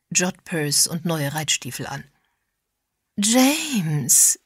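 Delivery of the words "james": delighted drawn-out call, pitched higher. A narrator's reading. Woman greeting James as she spots him, calling out. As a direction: sing out the name in greeting. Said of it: "rief sie, als sie ihn erblickte".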